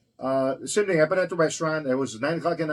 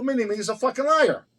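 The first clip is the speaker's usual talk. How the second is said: higher-pitched mock outrage; retold indignant accusation; 9 semitones higher, 4 dB louder than usual. Accusing an officer of lying, retold indignantly. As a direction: throw back the accusation, indignant.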